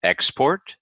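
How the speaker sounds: EXPORT as ex-PORT, rising high on PORT; list-like rise on the end of EXPORT; second-syllable stress. Shown as a demonstration. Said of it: "the second syllable is stressed".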